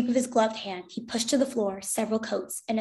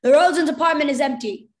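higher, louder announcement; raised-voice soldier's report; German soldier calling; 5 semitones higher, 8 dB louder than usual. A narrator's reading. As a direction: call out the report. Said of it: "Another German voice said".

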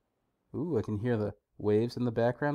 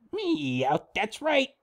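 squeaky, much higher cat Pokémon voice; Meowth voice; 9 semitones higher, much slower than usual. Acted. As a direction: play a cartoon cat talking. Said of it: "It's my Meowth voice".

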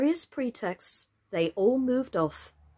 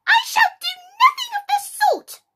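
very high, loud outraged squeak; indignant refusal; 9 dB louder than usual. A narrator's reading. Offended mouse refusing Alice's help, indignantly. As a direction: refuse furiously in a piercing mouse voice.

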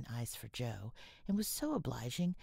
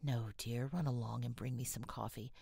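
ordinary delivery, nothing marked

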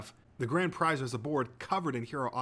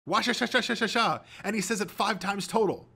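high, loud rapid-syllable chant then answer; frantic contestant; 9 semitones higher, 5 dB louder than usual. A narrator's reading. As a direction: blurt the slogan with manic zeal. Contestant imitates commercial, gleeful answer.

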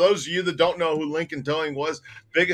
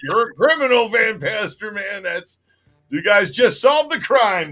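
mock cop; 6 semitones higher, 5 dB louder than usual. Acted quote of a cop.

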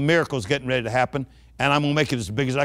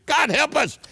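exclaiming; 10 semitones higher than usual. Real, not acted. Exclamation about tax collectors.

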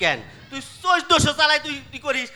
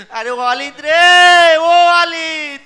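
very slow, loud drawn-out call; wailing call; 2 semitones higher, 7 dB louder, much slower than usual. Acted. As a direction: wail the name across the house. Father wailing for last son. (টেনে টেনে বিলাপের সুরে ডাকা)

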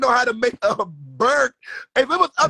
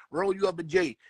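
ordinary delivery

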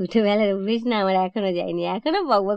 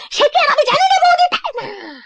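much higher, loud retort in a put-on maid voice; maid's indignant shout; 4 dB louder than usual. Maid snaps back at the sister-in-law, high indignant shout. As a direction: fling it back as the offended maid.

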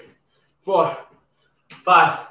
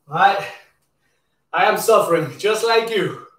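usual speaking voice

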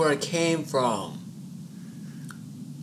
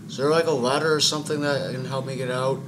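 ordinary delivery

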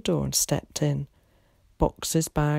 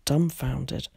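usual speaking voice

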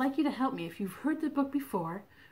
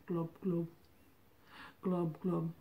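low, soft bubbling fish; goldfish glub; 5 semitones lower, 5 dB quieter than usual. Pretend. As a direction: glub quietly like a fish underwater. Imitating the goldfish.

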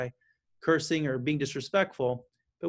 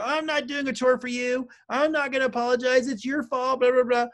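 higher, louder mock-defiant kid whine; 8 semitones higher, 4 dB louder than usual. Parent coach imitating a defiant child refusing chores, higher and whiny. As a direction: play the kid digging in and refusing. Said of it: "'cause they're gonna go".

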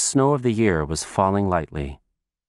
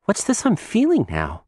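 put-on little girl voice; 11 semitones higher than usual. Child voice.

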